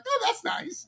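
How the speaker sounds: falsetto. Retold or imitated. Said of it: "I was like".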